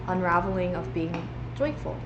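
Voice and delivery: ordinary talk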